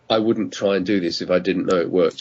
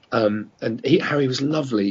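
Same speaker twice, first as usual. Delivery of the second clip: ordinary talk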